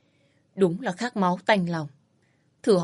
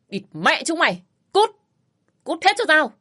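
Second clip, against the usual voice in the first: loud, high furious yell; furious shout; 9 semitones higher, 4 dB louder than usual. A narrator's reading. Father-in-law roars at the quarreling family, enraged. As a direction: scream them out of the room.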